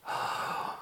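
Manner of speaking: soft, awed gasp; amazed gasp. Acted. Young man looks over the wall, amazed gasp. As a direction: breathe out a hushed gasp of wonder. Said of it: "he gasps".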